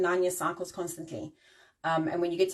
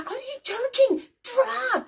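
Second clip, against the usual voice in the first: much higher, slow and louder, disbelieving; exasperated yelling; 4 dB louder, much slower than usual. Retold or imitated. Driver yelling at a slow car in disbelief. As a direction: yell it at the car ahead like it's absurd. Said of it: "I'm like".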